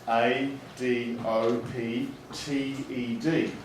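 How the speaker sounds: letters spelled out slowly; slow school dictation. Shown as a demonstration. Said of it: "teacher demos spelling aloud".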